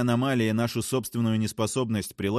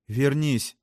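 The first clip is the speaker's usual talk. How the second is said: ordinary delivery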